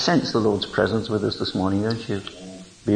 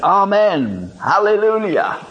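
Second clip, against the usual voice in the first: amen, hallelujah called out higher; calling out; 11 semitones higher, 5 dB louder than usual. Shown as a demonstration. Preacher leading the congregation, calling out. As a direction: lift the praise up. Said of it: "Let's say it all together".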